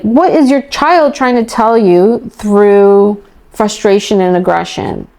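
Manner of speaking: ordinary talk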